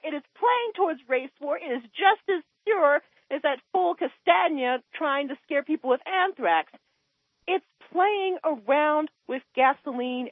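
real agitation lifting the voice. Real, not acted. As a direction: still worked up, pitched high but easing down. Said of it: "You're upset".

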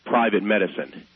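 usual speaking voice

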